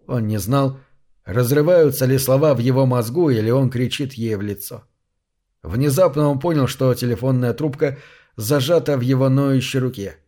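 ordinary delivery, nothing marked

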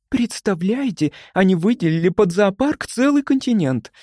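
high, thrilled gushing; breathless delight. A narrator's reading. Любочка telling colleagues about the zoo, thrilled. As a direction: gush as if overcome with delight. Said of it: "задыхалась от восторга Любочка".

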